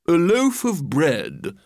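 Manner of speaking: slow, swooping grand pronouncement; pompous walrus. Acted. Walrus names what he needs for the meal, pompously. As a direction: announce it with drawn-out relish. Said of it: "the walrus said".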